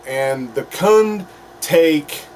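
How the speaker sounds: ordinary talk, nothing marked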